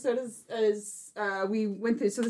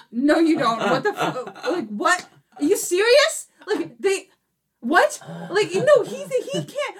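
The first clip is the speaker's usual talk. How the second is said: rising, louder, half-laughing disbelief; can't believe what she just heard; fiance's disbelief; 7 semitones higher, 3 dB louder, slower than usual. Retold or imitated. Fiance reacting to the spoiler, climbing in disbelief. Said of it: "And she's just like".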